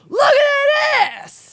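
drawn-out high yell, every word stretched; Dutch's yelling voice. Retold or imitated. Reader quoting a fan's yoga tweet, drawn-out yell. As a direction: holler it long, high and loud. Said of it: "Dutch's yelling voice in my head".

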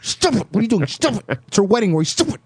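high, hushed mom hissing at her husband; squeaky, sharp under-the-breath nagging; scolding mom. Retold or imitated. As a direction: mimic an embarrassed mother's urgent stage whisper. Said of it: "all you hear is mom saying".

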